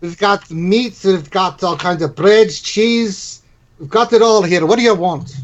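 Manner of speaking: proud innkeeper. Acted. Innkeeper offering the party all his food, proud and slow. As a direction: show off the larder.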